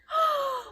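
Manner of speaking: sharp, high gasp; shocked gasp. A narrator's reading. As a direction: gasp in horror. Shocked reaction.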